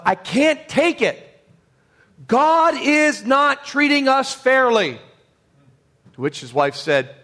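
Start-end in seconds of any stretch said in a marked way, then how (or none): 0.0-5.0 high, slow, strained cry of despair; pitched well up, drawn out in anguish. Retold or imitated. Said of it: "he said to his wife".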